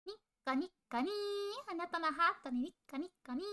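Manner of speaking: high, bright anime chant; sing-song idol catchphrase, fast; nico nico ni catchphrase. Pretend. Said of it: "I can do a nico nico ni for you".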